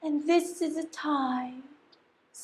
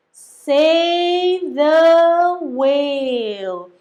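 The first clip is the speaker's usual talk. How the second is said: very slow, loud spelling-out; slow emphatic call; drawn out; 8 dB louder, much slower than usual. Acted. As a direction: stretch each word like a banner. Trail's message read out.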